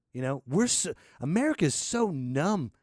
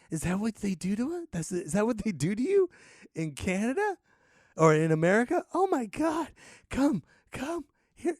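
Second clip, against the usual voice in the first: high, horrified questions turning to coaxing; mock frightened concern; 8 semitones higher than usual. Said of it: "People would be afraid".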